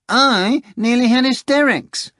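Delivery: high, slow, haughty cat voice; a prim talking cat, taking offence; Behemoth the cat voice. Acted.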